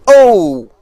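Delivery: loud, high cry of surprise; sudden "oh" as the ball drops close; startled yell. Real, not acted. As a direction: yelp out, falling in pitch. Ball drops near the booth.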